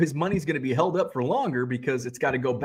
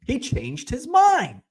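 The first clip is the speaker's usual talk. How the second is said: mocking; 10 semitones higher, slower than usual. Mocking the buyer's excuse.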